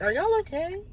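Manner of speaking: high, rising disbelief; incredulous question. Real, not acted. Host challenging viewers who blame him, incredulous. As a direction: push the question up in pitch, incredulous. Second, higher take of the challenge.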